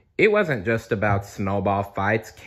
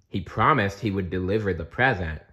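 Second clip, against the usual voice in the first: ordinary delivery, nothing marked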